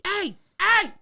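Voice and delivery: retold pleading to cool off; urgent calming. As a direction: call after someone to talk them down. Calming down a furious woman.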